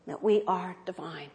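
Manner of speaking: ordinary talk, nothing marked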